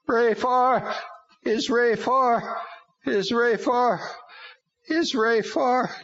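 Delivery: high demon voice, stuck on repeat; broken record. A narrator's reading. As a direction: loop the words mechanically like a demon. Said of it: "like a sickening broken record".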